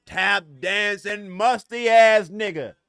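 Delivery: very slow, hammering each word of "Tap dancing"; deliberate repeat, meaning every word, "musty ass" punched hard; emphatic insult. Real, not acted. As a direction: drag it out, landing loud on "musty ass". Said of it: "I meant every motherfucking word I said".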